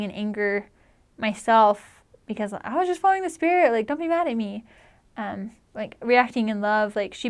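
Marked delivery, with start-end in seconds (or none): 2.5-4.6 high-pitched mock protest; defensive protest. Missionary to her angry companion, protesting in a high voice. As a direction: plead innocence in a raised pitch.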